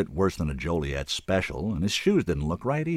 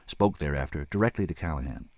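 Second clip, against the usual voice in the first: ordinary delivery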